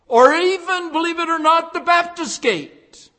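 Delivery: playful jab at Baptists; wry punchline. Acted. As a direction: lift up for the joke.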